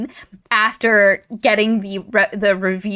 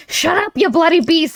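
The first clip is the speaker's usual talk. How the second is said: lightly put-on accent for Wendell, higher pitched; Wendell's accent; 7 semitones higher than usual. Assistant lady scolds the beast, in Wendell's accent. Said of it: "you hear in Wendell's accent".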